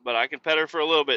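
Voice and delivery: ordinary talk, nothing marked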